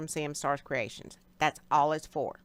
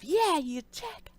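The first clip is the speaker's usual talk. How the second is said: put-on child voice; 9 semitones higher than usual. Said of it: "Little Bug pops off".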